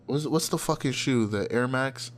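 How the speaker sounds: normal talking voice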